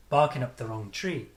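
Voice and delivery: full rhythm, main stress on "tree" in "Barking up the wrong tree"; strong-weak contrast. Shown as a demonstration. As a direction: punch "Bar" and "tree", reduce "up the". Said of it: "So the contrast".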